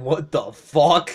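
slow, drawn-out baffled elephant; slow mock-dumb animal voice. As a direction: voice the confused elephant, slowly. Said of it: "The elephant's going".

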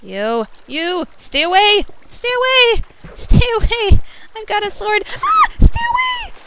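high frantic shouts; panicked yelling. Real, not acted. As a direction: yell at it in real panic, sword up. Fighting off a creeper.

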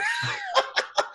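squeaky burst of laughter; sudden giggle; high-pitched laugh. Real, not acted. Laughs at holding hand.

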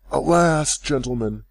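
dismayed cry. A narrator's reading. Rip crying out to the crowd, dismayed. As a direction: plead in sudden dismay. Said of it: "cried Rip, somewhat dismayed".

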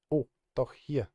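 ordinary delivery

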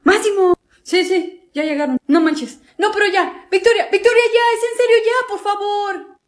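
fed-up brother shouting over the singing; exasperated yelling. Acted. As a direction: yell at her to quit.

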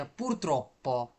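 "purtroppo" drawn out slowly, low, with a long double p; pronunciation model. Shown as a demonstration. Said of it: "উচ্চারণ করেন একবার সবাই". ("purtroppo" ধীরে টেনে উচ্চারণ)

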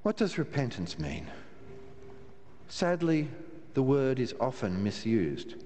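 ordinary delivery

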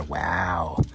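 usual speaking voice

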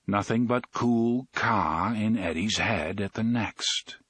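usual speaking voice

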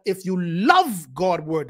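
LOVE drawn out and leaned on; stress on LOVE. Shown as a demonstration. Preacher showing where the emphasis falls, stress on LOVE. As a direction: put the weight on the word LOVE. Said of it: "that should be the emphasis".

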